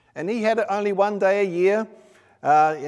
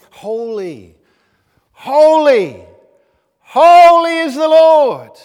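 building emphasis; 6 semitones higher, 16 dB louder, much slower than usual. Retold or imitated. Preacher voicing the angels' praise to show emphasis, building to a shout. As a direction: start at usual level, each holy louder, ending in a high shout. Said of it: "it's about emphasis".

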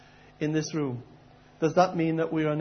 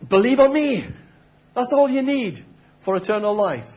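higher, louder, proclaiming voice; imagined Jesus answer; 6 semitones higher, 6 dB louder than usual. Retold or imitated. Preacher voicing his guess at Jesus' reply, loud and proclaiming. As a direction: announce it like a grand answer. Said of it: "I would have taken a guess at".

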